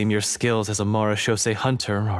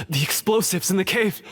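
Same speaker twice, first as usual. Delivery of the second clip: alarmed; 7 semitones higher than usual. Acted. Sudden realization.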